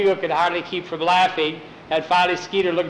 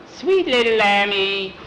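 slow, higher, sugary taunt; teasing coo; 4 semitones higher, slower than usual. Acted. Skeeter kicked in the shins by the lamb, teasing coo. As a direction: draw it out in mock affection.

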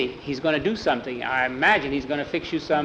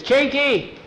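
higher called name toward the kitchen; calling out; 10 semitones higher than usual. Acted. Boss calling the cook out of the kitchen, high call. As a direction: call him in from the next room.

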